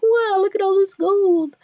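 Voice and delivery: high, loud gawp at treasure; hammed-up wowed treasure hunter; amazed. Voicing characters amazed at treasure.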